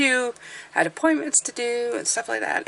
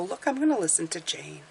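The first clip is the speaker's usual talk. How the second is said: softly voiced easygoing listener; relaxed listener; 7 dB quieter than usual. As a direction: settle back and say it quietly. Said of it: "sit back, relax, have a coffee".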